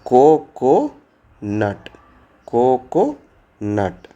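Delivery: teacher modelling the word slowly; slow spelling-out demo. Shown as a demonstration. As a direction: stretch the word slowly for the class. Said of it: "तो coconut".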